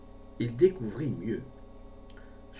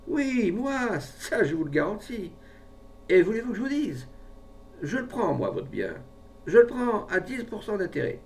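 high, insistent declaration; emphatic offer; 10 semitones higher than usual. A narrator's reading. Pharmacist offers to take the man's fortune, emphatically. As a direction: leap in and make the bold bid.

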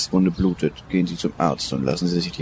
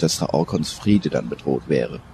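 ordinary delivery

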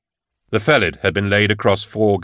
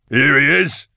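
raised, higher-pitched announcement; crying out; 6 semitones higher, 3 dB louder than usual. A narrator's reading. Falcon presenting his guest to the crowd, crying out. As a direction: call it out to the room. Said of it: "Falcon cried".

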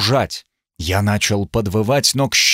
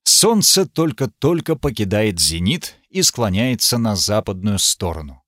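usual speaking voice

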